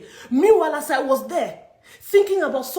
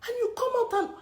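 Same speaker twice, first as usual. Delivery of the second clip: softer, higher, near tears; tearful anger; 4 semitones higher, 4 dB quieter than usual. Real, not acted. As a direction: choke it out hurt and angry. Tearful complaint.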